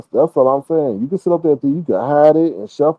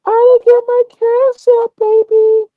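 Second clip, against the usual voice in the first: much higher, louder cooing woman's voice; sweet-talking woman; 6 dB louder, slower than usual. Retold or imitated. Imitating the woman begging Lemon for Cash App, high cooing. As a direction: coo it like she wants money. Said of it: "She said".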